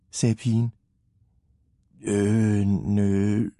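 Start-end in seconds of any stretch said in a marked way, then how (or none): 2.0-3.5 drawn-out, unsure answer; awkward hesitation. A narrator's reading. Leo admits he never tried it, awkward hesitation. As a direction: stall on each sound, sheepishly.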